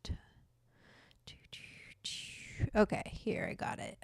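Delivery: hushed, breathy whisper to the pet. Real, not acted. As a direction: keep it to a whisper.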